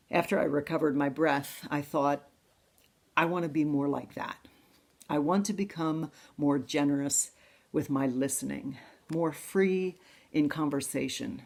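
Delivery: usual speaking voice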